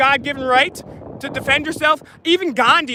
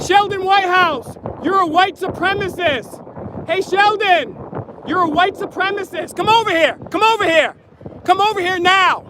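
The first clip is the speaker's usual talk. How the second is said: high, raised call across a crowd; calling from far away; 5 semitones higher than usual. Real, not acted. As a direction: call out to him from a distance. Protester calling out to a politician.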